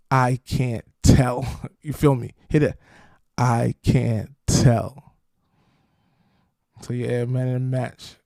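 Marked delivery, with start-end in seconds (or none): 0.1-1.4 slow mock drawl. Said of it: "performs a slow drawn line". 3.4-5.2 slower drawl. Pretend. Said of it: "repeats it slower".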